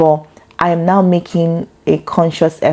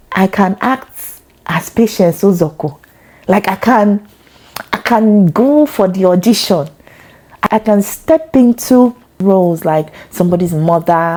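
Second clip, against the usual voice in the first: put-on light Nigerian auntie accent; 3 semitones higher than usual. Would-be actress trying out an auntie role, light Nigerian accent. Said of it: "African auntie".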